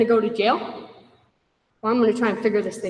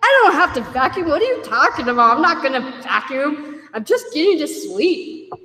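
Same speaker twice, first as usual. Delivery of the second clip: irritated retort; 5 semitones higher, 4 dB louder than usual. Retold or imitated. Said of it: "And I'm like".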